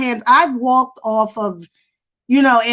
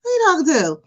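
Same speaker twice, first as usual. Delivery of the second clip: raised, sassy challenge on the quote; sassy comeback; 6 semitones higher, faster than usual. Retold or imitated. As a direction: snap back with attitude, still in you. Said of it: "I'll be like".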